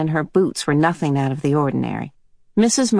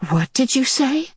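a touch of shaken fear; mild trembling; 8 semitones higher than usual. Acted. Mrs Murray shaken by Mrs Watson's word, mild tremble. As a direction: ask it barely steady. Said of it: "Her voice trembled".

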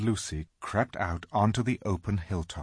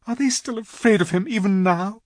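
high, louder anguished question; distraught girl; 12 semitones higher, 5 dB louder than usual. A narrator's reading. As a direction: cry it out in disbelief. Susan crying out in grief.

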